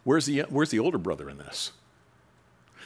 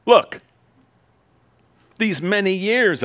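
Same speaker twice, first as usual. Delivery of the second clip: angry Look; 9 semitones higher, 7 dB louder, slower than usual. Acted. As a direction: Look yelled much higher and louder. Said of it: "this is not loving conversation".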